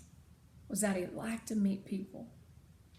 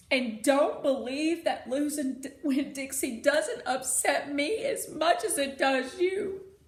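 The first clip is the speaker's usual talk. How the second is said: louder and higher, choked; tearful outburst; 4 semitones higher, 8 dB louder than usual. Acted. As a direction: cry it out. Preacher crying about the dog.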